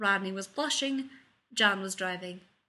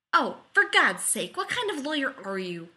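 higher, impatient outburst; impatient demand; 5 semitones higher, 6 dB louder than usual. A narrator's reading. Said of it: "clearly impatient".

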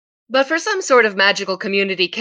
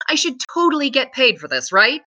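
high-pitched, running scolding; berating, demanding; 5 semitones higher than usual. A narrator's reading. Penny demands answers and pay from Matthew, berating. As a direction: berate him all in one breath. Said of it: "to berate him in the same breath as demanding".

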